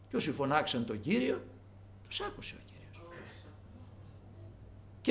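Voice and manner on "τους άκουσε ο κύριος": hushed whisper. Real, not acted. Preacher's hushed assurance.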